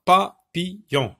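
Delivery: papillon in three short beats, pa-pi-llon; syllable by syllable. Shown as a demonstration. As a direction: sound out papillon with brief gaps. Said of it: "la syllabe pi".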